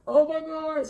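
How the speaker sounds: high, loud distressed gasp; overwhelmed cry. Retold or imitated. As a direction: cry out, close to tears. Overwhelmed by spirit's command.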